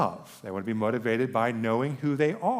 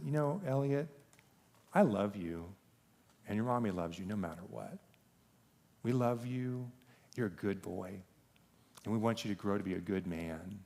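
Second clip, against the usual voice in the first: soft, gentle retold fatherly talk; tender reassurance; 8 dB quieter than usual. Father talking to his son after prayers, tender. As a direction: reassure a child softly, with pauses.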